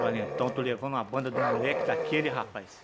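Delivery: quiet grumbling. Acted. Vendor grumbling to himself.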